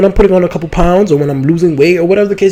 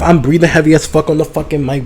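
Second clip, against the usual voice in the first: normal talking voice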